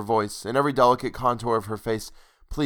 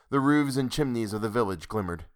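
ordinary delivery, nothing marked

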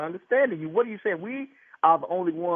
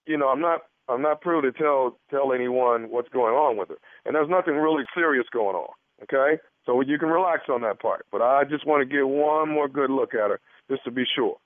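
lower, lightly voiced physician; put-on doctor; 4 semitones lower than usual. Prankster doctor reassuring the husband, evasive.

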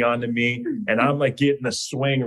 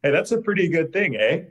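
mock Canadian accent; 6 semitones higher than usual. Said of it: "she already has me on the".

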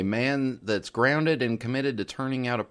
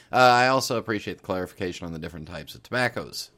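usual speaking voice

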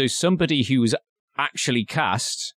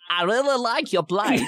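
put-on high-pitched New York accent slipping into Borat; botched New York accent; 10 semitones higher than usual. Host retries the line for co-hosts, failed New York accent. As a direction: badly fake New York until it sounds like Borat. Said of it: "so I've turned into Borat".

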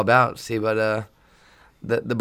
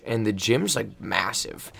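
ordinary talk, nothing marked

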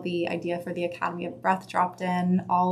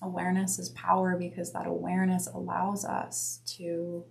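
guided meditation; 3 dB quieter than usual. Acted. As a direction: guide them gently inward. Leads a breath meditation.

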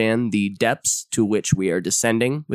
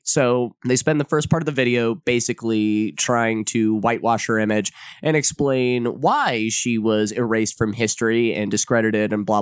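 ordinary talk

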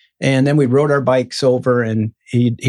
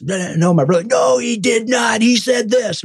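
put-on high, insistent kid's protest; mock argument; 9 semitones higher than usual. Brother insisting in the sermon argument, high and insistent. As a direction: argue the point like a stubborn kid brother.

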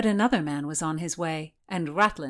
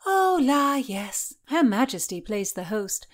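pitched-up chatty lady-in-waiting; Mary's voice; 5 semitones higher, 4 dB louder than usual. Acted. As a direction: beckon her in a lilting high voice. Mary gossiping.

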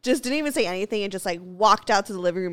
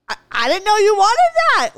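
higher and louder, hammed-up whimpering; fake-sob her defence; mock whiny cry; 12 semitones higher, 9 dB louder, faster than usual. Insisting to her boyfriend she didn't know, mock crying whine.

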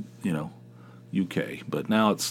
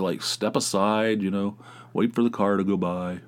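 ordinary delivery

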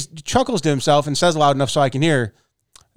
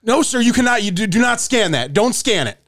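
higher, half-shouted mock panic; retold freak-out; 7 semitones higher than usual. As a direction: play the attendant losing it, barking him off the scanner. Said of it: "he flipped the fuck out".

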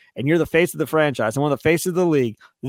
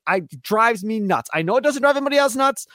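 ordinary delivery

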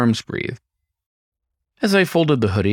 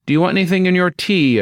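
raised, high call to another room; 10 semitones higher, 4 dB louder than usual. Acted. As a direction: call it out from the kitchen. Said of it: "I called out".

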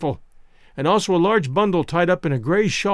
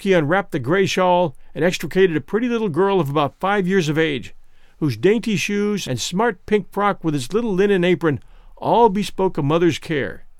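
plain reading voice

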